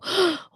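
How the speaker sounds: sharp, high gasp; startled gasp. Real, not acted. Learner suddenly spotting the accent difference, startled gasp.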